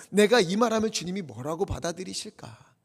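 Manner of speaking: normal talking voice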